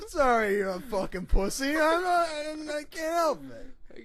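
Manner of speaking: fake-sincere taunt; taunting the beaten man with fake regret; mock apology. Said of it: "He's like".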